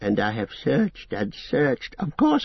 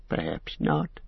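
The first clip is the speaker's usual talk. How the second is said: lower, softer repeat of perhaps not; fading echo; 5 semitones lower, 6 dB quieter than usual. A narrator's reading. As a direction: let "perhaps not" sink away to himself. Gollum echoing himself.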